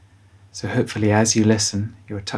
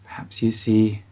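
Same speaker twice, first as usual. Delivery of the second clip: guided bedtime meditation. Acted. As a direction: guide children gently towards sleep, unhurried.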